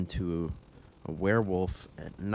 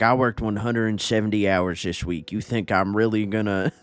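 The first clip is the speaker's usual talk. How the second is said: put-on loud, grumpy old man complaint; 3 semitones higher, 8 dB louder than usual. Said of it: "it sounds like an old man".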